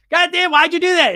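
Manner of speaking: loud, high-pitched self-scolding; exasperated outburst. Acted. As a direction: berate yourself in mock outrage. Acted inner voice berating himself.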